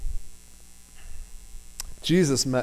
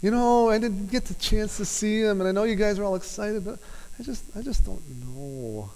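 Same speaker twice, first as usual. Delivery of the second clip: higher, hesitant mock whine, rushing then trailing off; wishy-washy Thomas; 8 semitones higher than usual. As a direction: play a timid doubter shrugging it off. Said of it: "it's not just".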